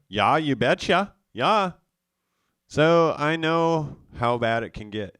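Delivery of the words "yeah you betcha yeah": imitating a North Dakota "you betcha", loud and higher; folksy Upper Midwest "Yeah, you betcha"; North Dakota accent. North Dakota accent, mocking family. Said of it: "family from North Dakota".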